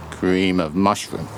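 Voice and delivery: normal talking voice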